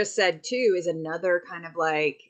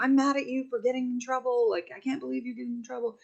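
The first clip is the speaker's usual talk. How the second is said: imitated angry scolding; 7 semitones higher than usual. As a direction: imitate a parent's cross outburst in a higher voice. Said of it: "this reaction of, you know, anger".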